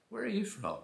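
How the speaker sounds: high-pitched imitation of a curious local; acted-out local's query; mock nosy questioning. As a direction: put on a high, prying voice. Locals question his origin.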